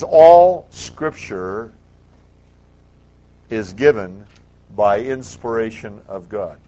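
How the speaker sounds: each word of All Scripture drawn out slowly and loudly, rising on All; preacher reading a verse slowly and loudly; slow emphatic reading. Shown as a demonstration. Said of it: "It says".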